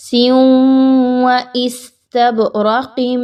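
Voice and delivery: سیوں و استبرقم slow, tanween merged nasally into waw; idgham with ghunnah. Shown as a demonstration. Teacher showing idgham with ghunnah, slowly. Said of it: "ادغام مع الغنہ".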